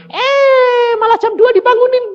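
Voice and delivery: high, loud, disbelieving complaint; outraged surprise. Retold or imitated. Preacher complaining God woke him at two, high and loud. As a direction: protest being woken at two in the night.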